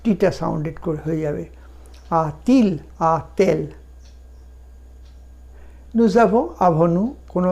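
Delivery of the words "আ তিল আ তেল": আ তিল then আ তেল, with a linking t before il and elle; slow teaching demo of the linking t; liaison t sound. Shown as a demonstration. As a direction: sound the borrowed t clearly. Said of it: "টি ঢুকিয়ে দিতে হয় আ আর ইলের মাঝখানে".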